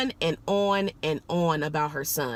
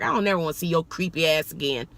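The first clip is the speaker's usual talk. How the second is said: ordinary talk, nothing marked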